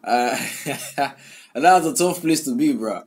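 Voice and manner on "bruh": ordinary talk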